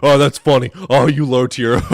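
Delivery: loud, laughing mock taunt; jeering troll. Host mimicking Phil bros' only comeback, mocking. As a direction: imitate a troll laughing at his own insult. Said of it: "that's their only thing".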